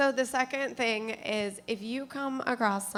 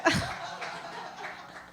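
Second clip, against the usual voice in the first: breathy laugh. Real, not acted. Laughing at own joke.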